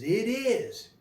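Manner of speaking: high, rising exclamation; joyful confirmation. Acted. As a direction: confirm it with a lift of excitement. Revealing himself to his bride.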